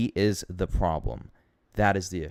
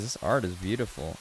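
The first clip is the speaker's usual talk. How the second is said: ordinary talk, nothing marked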